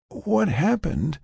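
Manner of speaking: slow, high, confused question; weak, recovering patient. Acted. Patient asks what happened, slow high weak voice. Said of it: "Her voice was becoming stronger".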